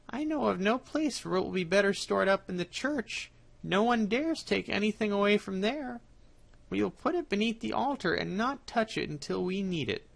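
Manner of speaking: sly cat. Acted. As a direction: play the cunning cat planning ahead. Cat proposes hiding place.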